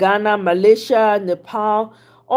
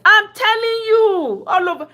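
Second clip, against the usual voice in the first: emphatic; 12 semitones higher than usual. Real, not acted. Warning about pastors' protests.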